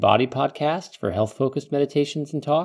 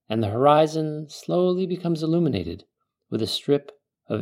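calm meditative tone; 3 semitones lower than usual. Acted. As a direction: ease the listener into calm. Leads a guided desert meditation.

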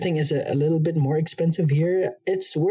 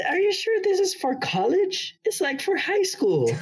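incredulous. Real, not acted. Mocking how easy college is.

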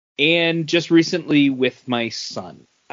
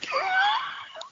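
sudden high burst of laughter; 5 dB quieter than usual. Real, not acted. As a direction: crack up at the joke.

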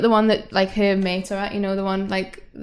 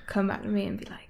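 whisper; 9 dB quieter than usual. Real, not acted. Whispered aside.

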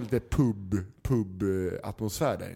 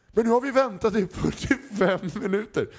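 high, loud complaining dad; an impatient father storming in, voice up high and loud; father furious about the long wait; angry father; 10 semitones higher, 5 dB louder than usual. Retold or imitated.

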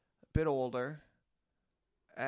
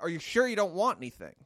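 high, loud repeated offer; retold pressing question, pitched up; pushy promoter; 4 semitones higher, 6 dB louder than usual. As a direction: imitate her insisting on the question. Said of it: "Then again, she's like".

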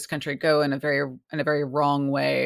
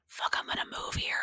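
breathy whisper of a private thought; 10 dB quieter than usual. Retold or imitated. Guest voicing her own thought about moving, whispered. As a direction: whisper it like a secret decision to yourself.

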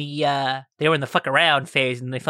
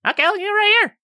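small character; 4 dB louder than usual. Acted.

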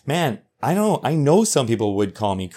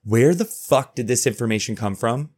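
ordinary talk, nothing marked

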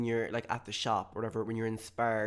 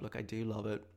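ordinary talk, nothing marked

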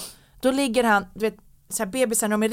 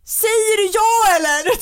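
high, loud hammed-up yell; imitated stressed shout; 13 semitones higher, 6 dB louder than usual. Fiancée imitating her stressed partner proposing over the crying, mock shout. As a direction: mimic a frantic partner yelling over a baby. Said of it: "Så han skriker ju så här".

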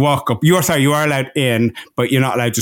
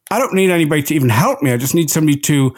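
fast rant; faster than usual. Retold or imitated. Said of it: "I was like".

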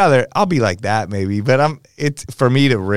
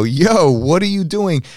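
indignant call out; 7 semitones higher, slower than usual. Retold or imitated. Woman throws drink at booth.

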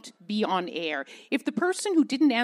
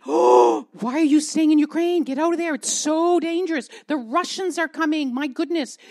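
higher, louder worried pleading; mock alarm; 6 semitones higher, 7 dB louder than usual. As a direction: imitate fretful friends urging her out. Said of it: "everyone was saying".

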